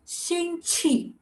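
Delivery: 星期 slow and high, each syllable held level; careful demonstration. Shown as a demonstration. Teacher gives class the word for week, careful demonstration. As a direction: stretch 星期 out syllable by syllable, high pitched. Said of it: "The word for week, just week, is".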